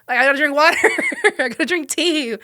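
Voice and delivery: a giggle breaking into the words; laughing through it. Retold or imitated. Sick and listing remedies to herself, laughing.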